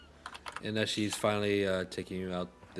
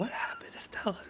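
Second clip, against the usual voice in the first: whispered question; 12 semitones higher, 4 dB quieter than usual. Acted. NPC whispers question to Estelle.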